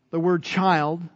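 usual speaking voice

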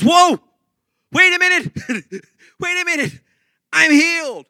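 high, loud amazed burst; excited discovery. Acted. Healed leper to his friends, high and loud with amazement. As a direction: stop short, stunned with joy.

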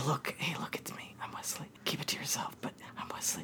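hushed whisper, barely voiced; Wesley whispering his secret to the princess. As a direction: whisper it like a secret only she should hear. Suggesting Wesley whisper his identity.

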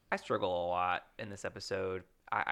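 ordinary delivery

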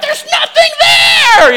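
very high, drawn-out mock scream; yelling back. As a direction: escalate into a hammed-up shriek of protest. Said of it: "we start yelling at each other".

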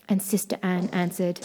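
plain reading voice

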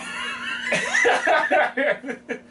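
loud high cackling shriek; shrieking laugh. Real, not acted. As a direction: howl with a piercing laugh. Blowjobs joke.

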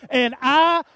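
loud, high, drawn-out exclamation; big slow storyteller's outburst; startled retelling. Retold or imitated. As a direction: stretch it out, reliving the jolt. Reliving the jump of fright.

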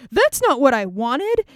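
a slow, loud, exaggerated cry of protest; hammed-up outrage, stretched out and loud; mock outraged yell. Samson's outburst over their deaths.

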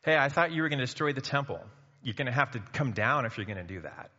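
loud, derisive heckling; mocking jeer. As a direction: taunt him like a scoffing passer-by. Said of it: "wagging their heads, saying".

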